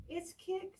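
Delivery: ordinary delivery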